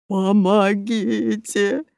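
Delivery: slow, drawn-out plea; pitiful stuck crook; plaintive. Acted. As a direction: whine for help, stretching each syllable. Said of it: "жалобно попросил Бруно".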